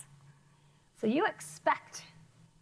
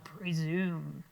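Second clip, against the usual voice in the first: PRESUME said low and drawn out; 7 semitones lower than usual. Shown as a demonstration. Teacher leads class saying presume, low voice. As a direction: drop presume deep down in pitch. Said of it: "Say it low".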